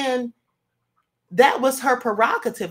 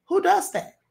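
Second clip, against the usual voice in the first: incredulous; 8 semitones higher than usual. Real, not acted. Reacting to a video, stunned and incredulous. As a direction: pitch jumps way up in disbelief.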